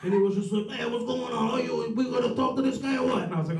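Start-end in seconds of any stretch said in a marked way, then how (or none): 0.7-3.2 higher-pitched caricature of a homicide cop; New York cop impression. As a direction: put on a big-bellied detective's voice.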